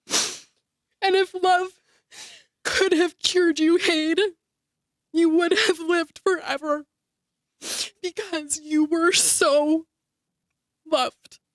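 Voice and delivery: high trembling farewell, drawn out slowly; voice breaking. Real, not acted. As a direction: fight through the tears, stretching each word. Eulogy climax, addressing Hayden.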